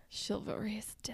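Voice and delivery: hushed breathy whisper. Acted. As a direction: whisper it like a secret. Mock-solemn aside about chivalry.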